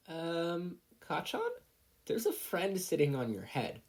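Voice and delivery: put-on high, hesitant little boy; a shy boy pointing out a bug; timid child voice. Little Izuku timidly warns Kacchan, high and hesitant.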